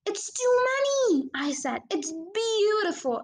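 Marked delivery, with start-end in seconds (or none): 0.1-1.3 overwhelmed. A narrator's reading. Boy overwhelmed by falling birds. 1.9-3.2 high, slow delighted cry. A narrator's reading. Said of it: "he cried".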